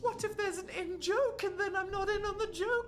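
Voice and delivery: high, worried whiny voice; high anxious voice. Retold or imitated. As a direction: fret like you'll miss everything. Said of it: "Whereas I'm like".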